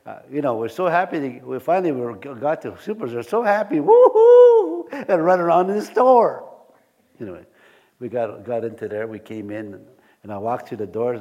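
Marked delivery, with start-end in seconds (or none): 3.3-6.5 much higher, louder woo-hoo; excited cheer. Retold or imitated. Pastor cheering as they reach Superstore, excited. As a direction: whoop with joy at the store.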